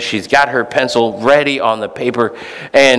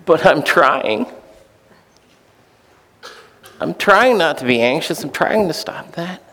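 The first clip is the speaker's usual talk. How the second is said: desperate plea; 4 semitones higher than usual. Retold or imitated. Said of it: "a desperate".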